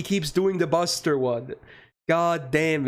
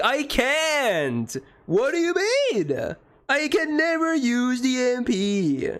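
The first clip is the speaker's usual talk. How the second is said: high, drawn-out whine of frustration; exasperated outburst; 7 semitones higher, slower than usual. Real, not acted. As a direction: wail in disbelief at being blocked. Frustrated outburst at blocked game move.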